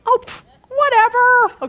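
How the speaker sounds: high, drawn-out scoffing puff; breezy dismissal. Acted. Friend shrugging off gossip about her, hammed-up dismissiveness. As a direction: brush it off with an exaggerated, slow 'whatever'.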